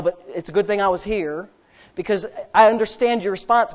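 usual speaking voice

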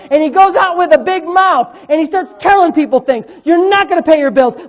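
high, loud, hammering sermon; fired-up preaching. Real, not acted. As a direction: preach hard, punching the key phrases. Preaches at full force.

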